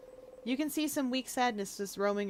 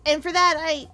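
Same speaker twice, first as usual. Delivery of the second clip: high, loud and pleading; eager girl character; 6 semitones higher, 9 dB louder than usual. Acted. Bonnie begging friend to teach her fighting, high and eager. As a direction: talk herself up, rushing with eagerness.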